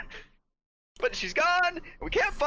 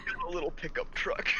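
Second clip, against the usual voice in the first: soft, whispery interjection; whispered aside; 9 semitones higher, 6 dB quieter than usual. Real, not acted. Player chipping in on the narrator's description, whispered. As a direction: slip it in quietly, almost all breath.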